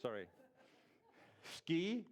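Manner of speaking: ordinary talk, nothing marked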